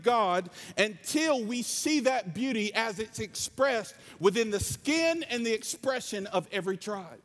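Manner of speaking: animated, high-pitched sermon delivery; impassioned pulpit teaching; preaching. Real, not acted. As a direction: preach with mounting fervor, stumbling ahead on repeated words. Impassioned preaching after quiet talk.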